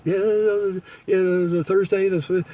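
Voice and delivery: half-heard phone chatter, lightly mocked; distracted receptionist.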